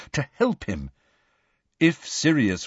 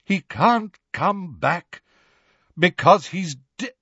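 voice breaking; 6 semitones higher than usual. A narrator's reading. Lupin trying to tell Harry of the death, voice breaking. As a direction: struggle towards the unbearable word. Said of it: "his voice breaking".

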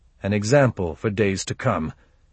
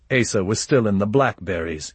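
ordinary delivery

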